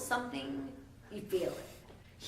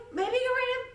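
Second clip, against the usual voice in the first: very high, loud taunting lilt; teasing challenge; 6 dB louder, faster than usual. Retold or imitated. The Wizard challenges Elphaba to read. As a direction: dangle the dare. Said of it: "They're like".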